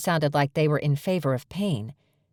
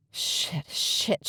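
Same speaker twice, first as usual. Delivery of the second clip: breathless whispered swearing; whispered curse; 2 semitones higher, 4 dB quieter than usual. A narrator's reading. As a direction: hiss it under your breath in shock. Discovers the bag, panicked swearing.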